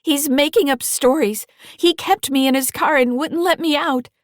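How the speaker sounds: put-on high, loud, indignant teen protest; a frightened girl insisting she was taken; girl pleading with state troopers, high and loud; upset teenage girl. A narrator's reading.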